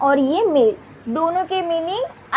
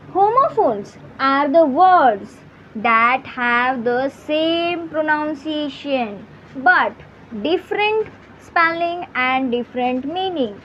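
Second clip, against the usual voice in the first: very slow, word by word, as if for students to copy; slow dictation; much slower than usual. Shown as a demonstration. Teacher dictating the definition to her students, very slow. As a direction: spell out the definition slowly, one word at a time.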